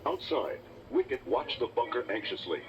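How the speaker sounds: ordinary delivery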